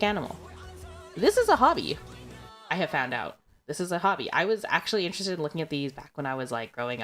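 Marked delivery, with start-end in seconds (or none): none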